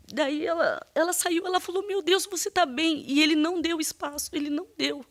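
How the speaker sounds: high, tight voice on the edge of tears; distressed. Real, not acted. Witness recounting the confrontation, real distress. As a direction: struggle to get through the painful account. Witness reaches traumatic part of testimony.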